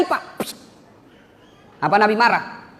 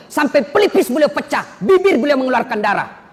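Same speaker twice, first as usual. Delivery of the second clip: fiery preaching; 6 semitones higher than usual. Acted. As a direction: drive the story home from the pulpit. Preaching at full force. (ceramah berapi-api)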